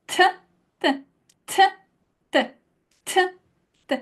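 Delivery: t with almost no air; dry Italian t; unaspirated t. Shown as a demonstration. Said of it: "Not a lot of air".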